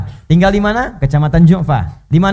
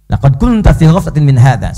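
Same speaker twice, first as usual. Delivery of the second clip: Quran recitation; 3 semitones lower, 6 dB louder than usual. Acted.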